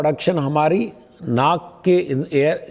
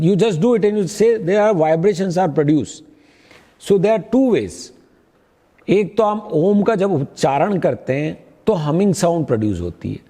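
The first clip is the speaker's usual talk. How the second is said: ordinary delivery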